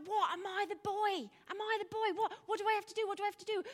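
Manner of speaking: put-on high, loud, panicked boy; lost boy asking in disbelief; bewildered boy. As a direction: play the startled boy, voice shooting up. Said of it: "And he's like".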